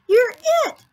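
very high, loud tag call; playful howl. Acted. Halibut tags a playmate, howling in play. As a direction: howl it out in a playground game. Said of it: "howled a halibut".

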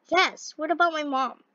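usual speaking voice

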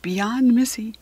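usual speaking voice

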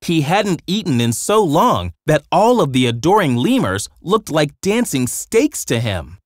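ordinary delivery, nothing marked

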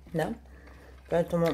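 ordinary talk, nothing marked

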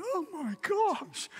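high, slow, breathless oh my gosh; stunned gasp. Retold or imitated. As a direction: let the shock stretch out, high and slow. Stunned by employee's outburst.

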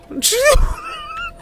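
high squealing burst of laughter; howling laugh; shrieking laugh. Real, not acted. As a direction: let out a shrill cackle.

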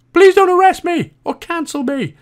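high-pitched, loud comic plea; mock pleading. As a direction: squeal it like a mock-desperate plea. Joking mock plea after risky remark.

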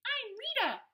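squeaky high voice for a small fish; Rita the remora. Acted. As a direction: chirp her name brightly. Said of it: "replied the remora".

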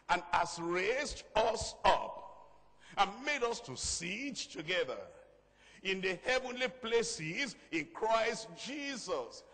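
slow, drawn-out verse proclamation; preacher declaiming scripture slowly; emphatic scripture preaching. Real, not acted. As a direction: read the verse out grandly, with long stressed words.

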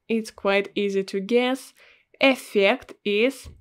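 ordinary talk

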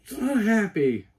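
high, drawn-out happy squeal; slow and high, bursting with joy; excited. Real, not acted. Cosplayer thrilled by new cowl, excited. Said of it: "it's got me so excited".

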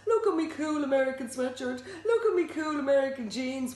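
high, sing-song mimicry of a show-off; mock your friend's boasting; mocking between breaths. A narrator's reading. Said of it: "Walker said between deep breaths".

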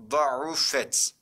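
"Da'ufet" with heavy D and throaty break before U; model reading. Shown as a demonstration. Teacher shows students how to read it, careful model reading. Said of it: "Okunuşu".

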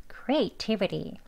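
quick native "creativity"; fast flapped. Shown as a demonstration. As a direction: rattle off "creativity" fast and relaxed. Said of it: "빨리 발음하면".

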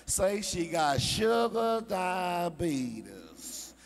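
hammed-up flashy preacher voice; ex-pimp faith healer. Ex-pimp TV faith healer working the crowd, hammed-up. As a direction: heal them with slow showman flair, then wild shouts. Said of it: "He be talking like this here".

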